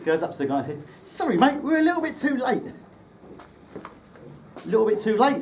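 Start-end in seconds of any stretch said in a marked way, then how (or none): 1.0-2.6 high, bright-up Cockney apology, much higher; chirpy London lad owning up; Cockney: cheery mate-ish apology pitched way up; cheerful Cockney. Retold or imitated. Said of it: "in his best cheerful Cockney". 3.8-5.4 slow, mocking Liverpool echo; lightly voiced sarcastic Scouse ferryman; sarky Scouser. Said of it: "says the sarky Scouser".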